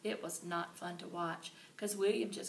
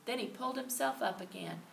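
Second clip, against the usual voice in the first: plain reading voice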